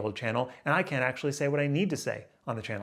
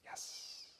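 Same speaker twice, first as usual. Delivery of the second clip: whispered check; 22 dB quieter than usual. Real, not acted. Checking the recording at the end.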